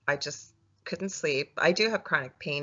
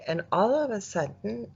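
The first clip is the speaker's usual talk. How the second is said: normal talking voice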